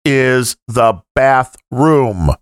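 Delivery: slow tourist English; talking to a foreigner. Pretend. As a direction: spell it out slowly for a foreigner. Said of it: "You just speak slowly and loudly".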